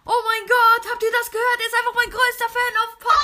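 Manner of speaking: high, gushing hype voice; high-pitched over-the-top podcaster. Acted. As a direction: gush excitedly up high. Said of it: "mit einer höheren Stimme geredet".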